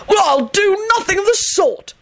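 high indignant howl; outraged wail. A narrator's reading. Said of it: "Arthur howled".

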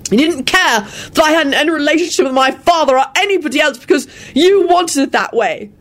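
high, fierce accusation. Acted. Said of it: "he finished fiercely".

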